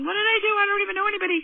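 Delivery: high-pitched retold panic; panicked thought. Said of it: "I'm, like, thinking".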